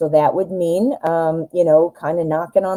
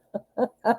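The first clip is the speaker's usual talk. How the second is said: laughing; 6 semitones higher, 7 dB quieter than usual. Real, not acted. Laughs at own coughing fit.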